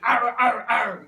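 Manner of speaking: loud fierce pirate ar; teacher showing a fierce pirate face and voice. Shown as a demonstration. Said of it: "You've got to be fierce".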